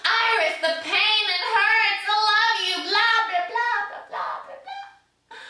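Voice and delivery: high, loud, hammed-up wail, fading on the blahs; mock pained moaning. Goddess mocking her injured priest's love cries, high moaning wail. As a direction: mimic a lovesick sufferer wailing, then trail away. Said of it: "who's moaning and groaning in pain".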